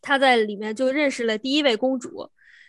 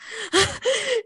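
embarrassed laugh; 11 semitones higher than usual. Real, not acted. Laughs at mixing up relatives.

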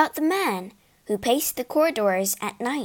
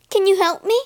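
higher, louder eager question; eager plea; 9 semitones higher, 5 dB louder, faster than usual. Acted. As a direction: ask full of sudden hope.

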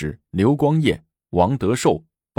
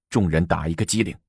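usual speaking voice

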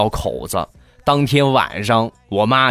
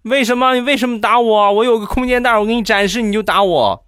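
high-pitched imitated wronged child; mock-wounded high whine; aggrieved; 11 semitones higher than usual. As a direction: protest as a hard-done-by kid, pitched way up. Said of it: "很委屈".

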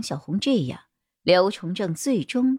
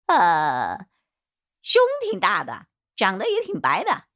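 pondering suspect; 3 semitones higher than usual. A narrator's reading. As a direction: think it over, then answer pitched up. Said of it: "光头男思索了一番回答". (思索着、尖着嗓子回答)

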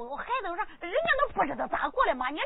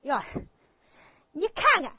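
normal talking voice

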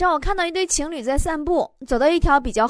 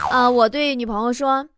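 ordinary talk

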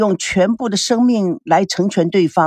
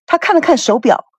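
ordinary delivery

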